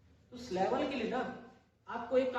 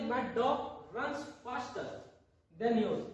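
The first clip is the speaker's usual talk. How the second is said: very slow, word by word; slow demo sentence; much slower than usual. Shown as a demonstration. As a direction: read my dog runs faster than yours slowly for the class. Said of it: "इसी प्रकार देखिए". (धीरे-धीरे एक-एक शब्द बोलते हुए)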